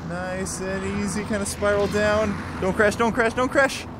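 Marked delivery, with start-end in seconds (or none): none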